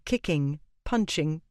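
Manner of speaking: ordinary delivery, nothing marked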